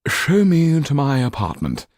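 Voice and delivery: Marquis demanding. A narrator's reading. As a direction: insist like a lord. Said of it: "he demanded".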